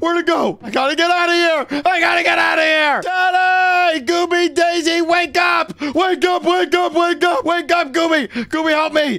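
high, frantic yelling for help; panicked screaming. Acted. Boy fleeing the ghost to wake family, high panicked screams. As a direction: holler desperately to wake everyone. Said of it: "Why are you screaming?".

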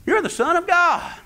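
panicked demon voices, acted by a preacher; demons crying out. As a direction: stutter it, then burst out high and loud.

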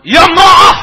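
teriak keras dan tinggi; teriakan keras. Retold or imitated. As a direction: menirukan bentakan Ibnu Umar dengan keras. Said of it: "Ngangkat dengan suara yang kencang". (Ibnu Umar membentak istrinya dengan keras)

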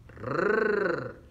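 long rolled trill on "Rrr"; R vibrante fuerte. Shown as a demonstration. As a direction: roll "Rrr" as one sustained, strong trill. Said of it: "repasando nuestra letra R". (erre vibrante, larga y sostenida en "Rrr")

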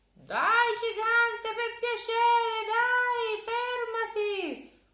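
very high, loud, whining plea; a child pretending he desperately needs the toilet; boy begging the giant to stop; pleading little boy. Boy begs.

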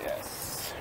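breathy whispered yes. Pretend. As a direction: whisper it like a conspirator. Whispered reply to villain line.

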